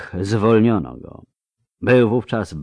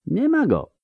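put-on calm old man; 13 semitones higher than usual. A narrator's reading. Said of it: "odrzekł spokojnie Ori Royven".

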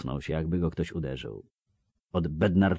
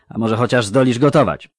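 higher, louder clipped-off questions of a bored officer; brusque recruiter; 5 semitones higher, 11 dB louder than usual. Acted. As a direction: bark the questions like a tired recruiter. Officer asks. (znudzony komisarz, głośno i wyżej)

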